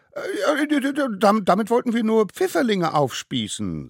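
very high, loud, stammering excuse; squeaky child caught out, stumbling over the first words; flustered little one. Acted. (hoch und laut stotterndes Kind)

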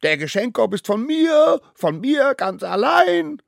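high, loud possessive yell; bellowing. A narrator's reading. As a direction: roar it like a furious little child. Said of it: "brüllte er". (trotzig und schrill gebrüllt)

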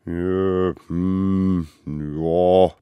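loud, drawn-out reluctant grumble; grumbling. A narrator's reading. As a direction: grumble a grudging yes. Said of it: "brummelten die kleinen Wilden". (widerwillig gebrummelt)

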